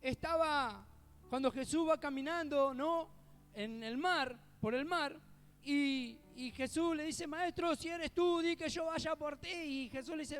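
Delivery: full-throated sermon delivery; preaching at full force. Acted. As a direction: preach it out to the congregation, slow and loud. Preacher building up the congregation.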